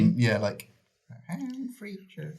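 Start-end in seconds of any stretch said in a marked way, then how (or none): 1.3-2.3 much higher, slow, softer sitcom-style American voice; modern TV accent. Retold or imitated. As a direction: drawl it like a slick TV sitcom star. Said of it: "His one is, like, modern T V".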